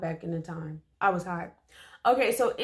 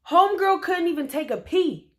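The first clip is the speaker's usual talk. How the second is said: higher, louder outraged exclaim; 9 semitones higher, 6 dB louder than usual. Real, not acted. Complaining to viewers about the stopped toilet, loud outrage. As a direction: blurt it in outrage. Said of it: "so disgusting".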